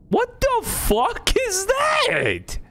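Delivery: long, high shocked yell; screaming at a sudden fright; startled shriek. Real, not acted. Startled by a jump scare, a long high shocked yell.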